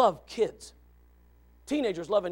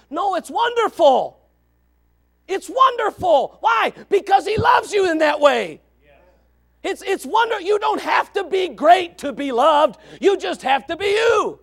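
preaching shout; 10 semitones higher, 10 dB louder than usual. Real, not acted. Preacher answering the grumbling churchgoer, full-voiced shout. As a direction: shout the good news at them.

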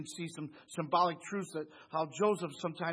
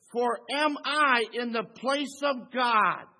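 emphatic slow reading; 5 semitones higher, 8 dB louder, much slower than usual. Shown as a demonstration. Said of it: "Notice it says".

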